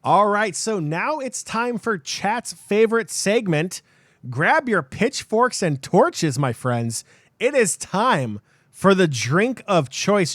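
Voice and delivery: slow, high showman announcement; announcer hype. Acted. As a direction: sell it like a game show host. Hyping the drinks segment.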